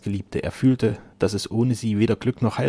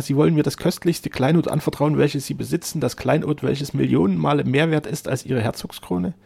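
higher, impassioned wonder; amazed joy; 7 semitones higher, 5 dB louder than usual. A narrator's reading. Otto asks the duke about this precious gift, raised and amazed. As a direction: gush in joyful disbelief. Suitor amazed at being given daughter.

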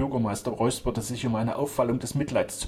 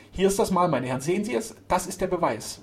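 raised, eager exclamation; excited proof; 8 semitones higher, 4 dB louder than usual. A narrator's reading. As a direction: point it out triumphantly. Servant excitedly shows the proof.

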